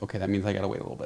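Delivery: soft, fast resigned mumble; quiet dog thought. Pretend. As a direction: rattle it off softly, resigned. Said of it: "So he's like".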